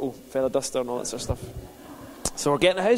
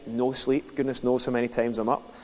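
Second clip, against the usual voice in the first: ordinary talk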